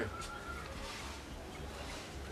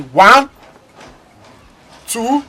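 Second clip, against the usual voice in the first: loud countdown; 7 semitones higher, 13 dB louder than usual. Acted. Counting down as a threat.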